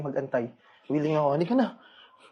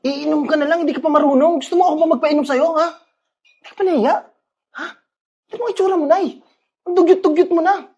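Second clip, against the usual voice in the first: angry shouting; 12 semitones higher, 7 dB louder than usual. Acted. Son yelling at mother in public.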